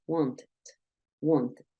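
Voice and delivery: "wanted" in two syllables, ending "ted"; ED clearly sounded. Shown as a demonstration. Teacher gives one last example, "wanted" with sounded ED. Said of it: "Suena esa E D".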